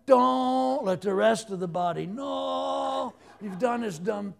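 high, slow, hushed cartoon voice of a hand; high, drawn-out squeaky voice, half laughing; the hand speaking. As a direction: play the embarrassed hand, coaxing it to hide the mistake. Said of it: "you know what my hand would say?".

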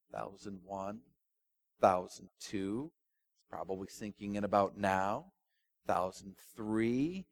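counting slowly under his breath, soft; slow silent count. Acted. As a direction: count the seconds slowly and quietly. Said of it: "counts slowly to himself".

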